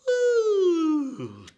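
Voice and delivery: high, loud whoop of delight; excited cheer; excited woo. Pretend. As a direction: cheer for the kids. Cheering while tracing the letter.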